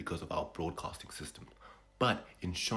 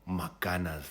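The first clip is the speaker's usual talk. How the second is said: Maganas, Gunners with a Shona ma- prefix; Shona accent; 3 dB louder than usual. Shown as a demonstration. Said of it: "Gunners in a Shona accent".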